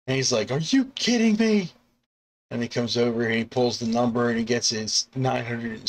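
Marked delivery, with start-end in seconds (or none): 0.5-1.7 high-pitched disbelief; much higher, exasperated mock protest; incredulous outburst. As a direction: squawk it in hammed-up indignation. Character's outraged reaction acted out.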